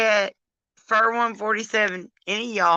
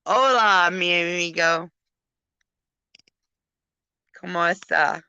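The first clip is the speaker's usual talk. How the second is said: playful fake Spanish greeting, English accent; mock Spanish. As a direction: pretend to be a Spanish speaker. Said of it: "That's me pretending like I speak Spanish".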